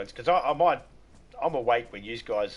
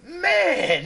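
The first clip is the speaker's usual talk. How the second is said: high, loud exasperated "Man"; exasperated groan; 7 dB louder than usual. Real, not acted. Recalling his most hated stream, a high loud groan. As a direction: groan it in comic despair.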